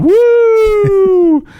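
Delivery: loud, high, sustained "woo"; high held siren-like wail; imitated wailing "woo". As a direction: mimic the noise outside with a long high whoop. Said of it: "Something keeps going".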